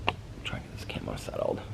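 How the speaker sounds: hushed whisper. Real, not acted. Owner whispering while setting the camera by her dog. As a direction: whisper so the dog stays calm.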